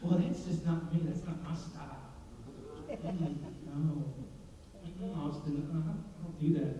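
low, quiet mock reluctance; reluctant refusal. Preacher mimicking someone declining to worship, quiet reluctant refusal. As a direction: back away softly, playing the unwilling worshipper.

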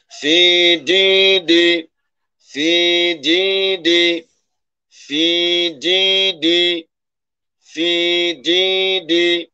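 slow, high chant of CDD; recited word demo. Shown as a demonstration. Teacher blends the word for students in slow chant. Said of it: "এবার আমরা মিলাই শব্দ".

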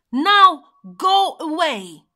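shouted. A narrator's reading. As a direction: shout it high and hard to send someone off. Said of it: "he shouted".

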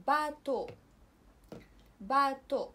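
French باتو, silent final x. Shown as a demonstration. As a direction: say باتو with no x at all. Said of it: "فما كنسمعوهاش".

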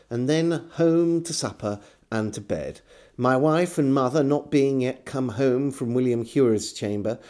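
ordinary delivery

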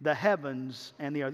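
normal talking voice

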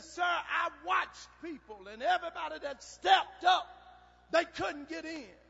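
high, insistent pleading; retold protest. Boy protests to the rider.

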